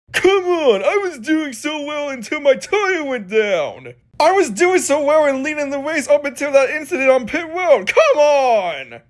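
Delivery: high-pitched, whiny acted outburst; frustrated protest. Driver protests his ruined race.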